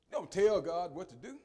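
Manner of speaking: ordinary talk, nothing marked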